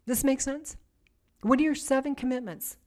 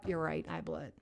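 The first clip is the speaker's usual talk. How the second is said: humble admission; 6 semitones lower, 10 dB quieter than usual. Shown as a demonstration. Humble confession to God.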